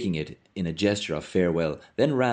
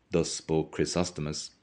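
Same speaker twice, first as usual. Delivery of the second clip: plain reading voice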